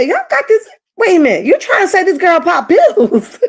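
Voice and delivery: high squeal of disbelief, laughing at the end; shocked disbelief. Retold or imitated. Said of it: "I said".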